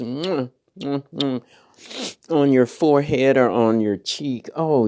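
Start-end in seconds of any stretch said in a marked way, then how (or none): 0.0-1.4 soft smacking air kisses; three quiet kiss sounds, mwah mwah mwah; blown kisses. Pretend. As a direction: kiss the listener through the mic. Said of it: "And big kisses".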